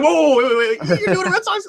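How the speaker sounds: high-pitched, stammered disbelief; astonished fan reaction. Acted. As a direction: act out a fan doing a double take. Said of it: "literally lost their mind".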